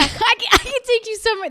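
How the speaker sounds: fast, high laughing imitation; mock the driver while laughing; laughing mimicry of the driver. Friend laughing as she imitates the Uber driver, fast and high.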